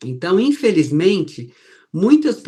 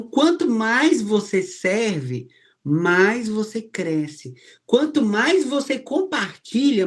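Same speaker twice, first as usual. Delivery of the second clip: higher, slow and measured, each "mais" leaned on; slow preaching refrain; 5 semitones higher, slower than usual. Acted. Pastor to the church, a slow rising preaching refrain. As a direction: pulpit cadence, drawing out every clause.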